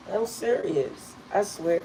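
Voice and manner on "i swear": normal talking voice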